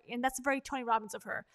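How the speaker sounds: ordinary talk, nothing marked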